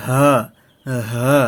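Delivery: "হা" pushed out from deep inside the throat; isolated, forceful throat-made "হা". Shown as a demonstration. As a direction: push a breathy h in "হা" up from the throat. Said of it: "ভিতর থেকে বের করার চেষ্টা".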